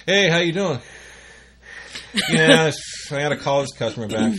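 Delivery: loud, chatty greeting booming over the speakers; loud rep on intercom. Retold or imitated. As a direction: play the oblivious rep yelling into the phone. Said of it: "echoing through the whole building".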